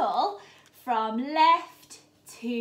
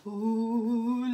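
usual speaking voice

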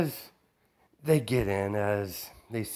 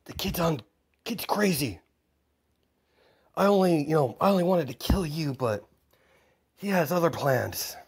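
put-on higher, excited menacing kid; villain kid voice; 6 semitones higher than usual. Game master voicing a crazy kid to the villain. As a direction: play the unhinged kid villain gleefully.

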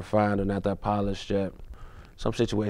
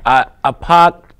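Boston accent attempt; 4 semitones higher, 13 dB louder, slower than usual. Shown as a demonstration. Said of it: "in your best Boston accent".

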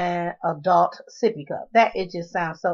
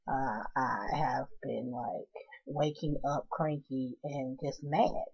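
grumpy whisper; 5 semitones lower, 12 dB quieter, slower than usual. Real, not acted. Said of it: "I just in a shitty mood".

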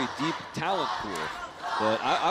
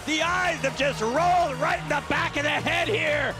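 high excited play-by-play shouting; shocked commentator; 10 semitones higher than usual. Real, not acted. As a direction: react in horror to the choke.

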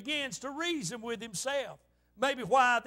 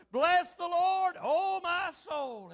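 higher, slow, drawn-out proclamation; leading congregational recitation; 5 semitones higher, much slower than usual. Shown as a demonstration. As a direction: lead the room, stretching each phrase. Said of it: "All right, here we go".

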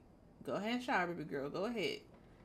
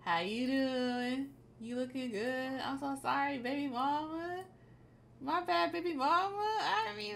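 a lightly higher, smooth pickup-line voice; smooth flirty guy; 6 semitones higher than usual.

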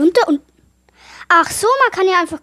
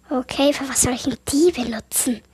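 whisper; 3 semitones lower, 4 dB quieter than usual. Real, not acted. Puzzling over an item alone.

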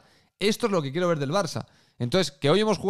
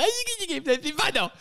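high squeal; squealing tease; 11 semitones higher than usual. Pretend. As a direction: squeal it out teasingly.

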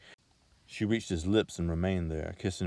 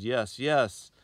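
breathless; 5 semitones higher, 9 dB louder than usual. A narrator's reading. Giving in under kisses.

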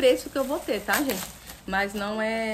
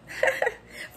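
high breathy laugh. Real, not acted. Laugh after puppy greeting.

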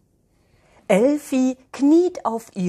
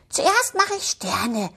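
cheerful murmur; 6 semitones higher than usual. Acted. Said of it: "murmelt Elfi vergnügt vor sich hin".

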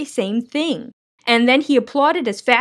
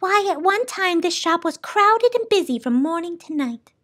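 higher-pitched character voice, lightly complaining; higher, animated storybook character; soundkeeper, wistfully proud; 9 semitones higher than usual. Acted. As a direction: recall busier days in a higher voice.

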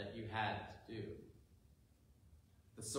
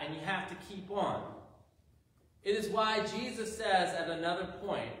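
ordinary delivery

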